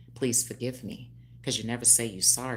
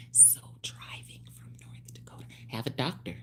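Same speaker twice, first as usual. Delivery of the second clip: whispered aside; 9 dB quieter than usual. Real, not acted. Whispered aside about a client.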